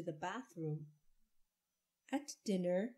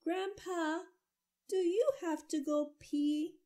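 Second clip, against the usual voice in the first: put-on high, louder child's voice; child calling; 9 semitones higher, 5 dB louder than usual. A narrator's reading. Andrew calling to Grandpa from upstairs, high child voice. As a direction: call down the stairs like a little boy. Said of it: "from upstairs Andrew said".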